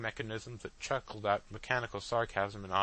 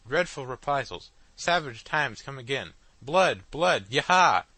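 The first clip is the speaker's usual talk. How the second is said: screaming machine; 3 semitones higher, 7 dB louder than usual. A narrator's reading. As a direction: scream the news at the hall. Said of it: "screamed deafeningly".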